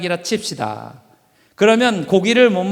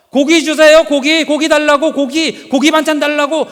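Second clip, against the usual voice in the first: put-on higher, louder childish nagging chant; whining for meat; 7 semitones higher, 6 dB louder than usual. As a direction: whine over and over for meat. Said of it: "계속 졸르기 시작했어요".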